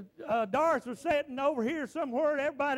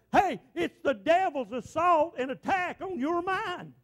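rising, louder preacher's cadence, slowed at peaks; preaching; 5 dB louder than usual. Real, not acted. Preacher to his church, preaching at full force. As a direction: preach it hard to the congregation.

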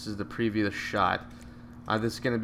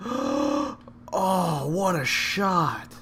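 high, slow amazed exclamation; excited gasp; 8 semitones higher, much slower than usual. Real, not acted. Golfer gasping at a great shot, high and amazed. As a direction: marvel at the shot, drawn out.

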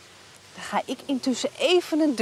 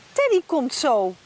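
ordinary talk

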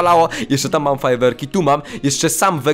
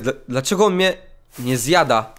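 usual speaking voice

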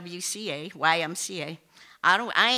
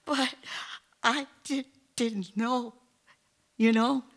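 put-on tearful child; 5 semitones higher than usual. Tearful son quoted.

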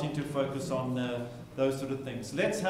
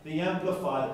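ordinary talk

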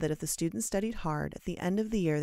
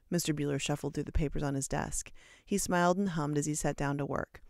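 ordinary delivery, nothing marked

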